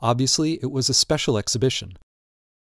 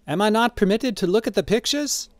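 high-pitched character voice, protesting; old man Drioli; 10 semitones higher than usual. A narrator's reading. Old man protesting to the gallery owner, high-voiced. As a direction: pitch it well up, querulous question.

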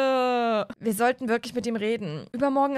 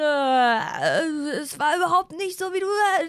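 put-on higher, halting drunk excuses; drunk teen; 5 semitones higher, 3 dB louder, slower than usual. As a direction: stammer the excuse like a tipsy teen. Drunk son stammering excuses.

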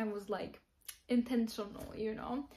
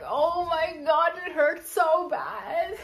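loud, slow, half-laughing mock wail of pain; mimicking his pain; 6 semitones higher, 6 dB louder, slower than usual. Telling viewers how the kicked guy moaned, loud mock wail. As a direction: ham up the guy's agony through your own laughter. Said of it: "he was just like this".

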